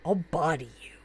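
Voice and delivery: low whispered menace; menacing whispered threat; Pam Poovey threat. Retold or imitated. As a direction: hiss the threat like a tough brawler. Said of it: "I think I've become Pam Poovey".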